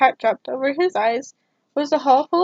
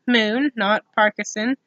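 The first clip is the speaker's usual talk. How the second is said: plain reading voice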